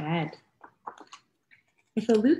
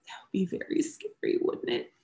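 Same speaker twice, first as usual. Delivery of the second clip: scary whisper; 7 dB quieter than usual. Acted. Scary moment, whispered to kids.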